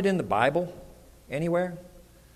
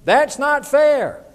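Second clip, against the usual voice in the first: put-on high, loud, drawn-out childish complaint; mock kid protest at full volume; 8 semitones higher, 5 dB louder, slower than usual. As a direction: imitate kids complaining, stretching every word. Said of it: "starting to hear from my kids".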